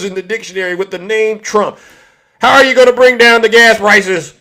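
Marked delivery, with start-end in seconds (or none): 2.4-4.3 loud, raised challenge; mock quiz question. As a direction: fire the question out mockingly. Mock quiz put to supporters.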